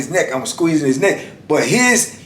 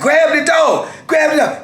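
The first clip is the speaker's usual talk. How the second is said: high, frantic retold command; acted-out cry for someone to act; urgent holler; 14 semitones higher than usual. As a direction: call out desperately, voice way up in pitch. Hollering back during the struggle.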